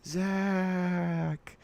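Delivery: eerie drawn-out ghost call; ghostly howl. Acted. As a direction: call the name like a wailing ghost. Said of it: "a ghostly howl".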